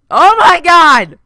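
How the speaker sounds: high loud slow cry of shock; loud, drawn-out shocked yell; startled shout. Real, not acted. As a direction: blurt it out in real alarm. Sudden scare in game.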